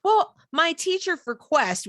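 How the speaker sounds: high, slow imitation of a child; daughter answering. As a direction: answer as a kid citing the teacher. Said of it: "she's like".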